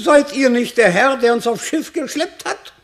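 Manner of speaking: put-on old shepherd spotting the man who dragged them aboard, surprised. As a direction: burst out as you recognize the rogue. Shepherd recognizes the rogue.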